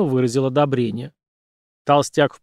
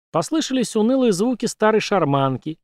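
ordinary delivery

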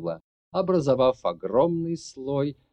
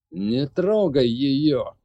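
slow, forceful order; commanding; 3 semitones higher, 6 dB louder, much slower than usual. Acted. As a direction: command it, drawing each word out. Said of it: "приказал Конор".

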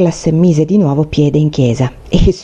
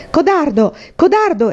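high-pitched jeering cry; exclaimed taunt; 8 semitones higher than usual. A narrator's reading. Bully calls boy a coward, exclaiming. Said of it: "esclamò di nuovo".

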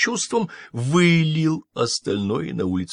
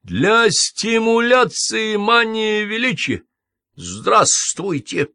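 slow, higher, louder mocking call; shout; 12 semitones higher, 5 dB louder, slower than usual. A narrator's reading. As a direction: bellow it down the street. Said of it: "закричал он вниз". (насмешливый крик)

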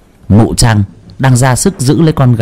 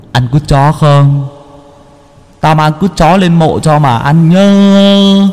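slow, higher, teasing spirit voice; mocking ghost; 5 semitones higher, slower than usual. Ghost taunts the family from the gate, slow and mocking. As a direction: taunt them from the grave.

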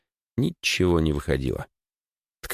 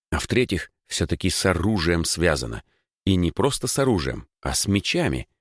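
ordinary delivery

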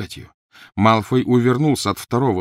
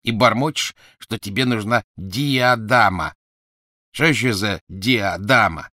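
mocking Goyle; 3 semitones higher, 4 dB louder, slower than usual. Goyle mocks Harry's muttering.

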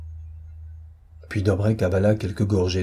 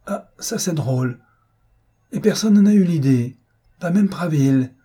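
high, louder burst of astonished delight; amused amazement; 7 semitones higher, 5 dB louder than usual. Acted. Marquis hearing his captive's secret, amused amazement. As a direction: exclaim at an unexpected revelation, pitched high.

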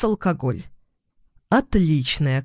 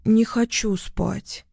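slow, higher, sleepy whine; mumbling; 4 semitones higher, slower than usual. A narrator's reading. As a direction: mumble it drowsily. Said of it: "мямлит она". (мямлит, медленно и сонно)

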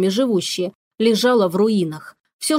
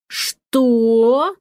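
thundering outrage. A narrator's reading. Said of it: "Дом тряхнуло полностью от подвала".

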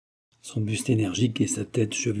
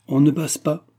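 higher, firm, unruffled order; stolid, louder command; phlegmatic; 5 semitones higher, 5 dB louder than usual. A narrator's reading. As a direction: block the way without any fuss. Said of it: "dit flegmatiquement le frère aîné".

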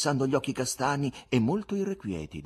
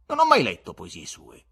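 loud, nettled retort; irritated exclamation; 3 semitones higher, 10 dB louder than usual. A narrator's reading. Visitor cornered about Ivan's poems, irritated. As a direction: burst out impatiently, higher and louder. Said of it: "esclamò innervosito il visitatore".

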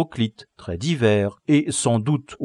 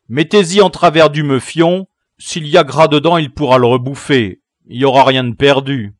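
put-on loud, higher commanding boy; bossy boy leader; 4 semitones higher, 11 dB louder than usual. Gang leader ordering prisoner gagged, loud and bossy. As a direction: bark the orders to your gang.

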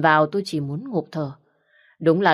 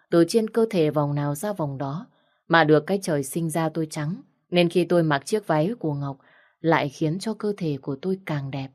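plain reading voice

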